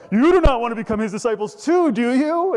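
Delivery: high, loud, quick mock question; sarcastic. Healed man teasing the Pharisees about discipleship, loudly sarcastic. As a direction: goad them with a big sarcastic tag question. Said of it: "It's so sarcastic".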